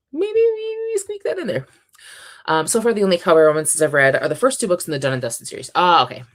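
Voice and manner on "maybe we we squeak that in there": tiny squeaky voice, way up high; coaxingly sneak the idea in, squeakily; high squeaky suggestion. Real, not acted. Proposing to Ren a non-indie exception, squeaky voice. Sheepish suggestion to bend rules.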